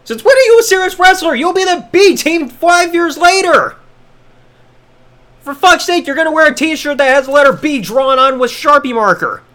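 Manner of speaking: high, loud incredulous rant; exasperated. Real, not acted.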